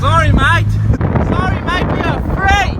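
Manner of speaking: loud high prank yell at strangers; calling out insults. Real, not acted. Yelling insults at passers-by, loud and high. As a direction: shout it across the street, high and loud.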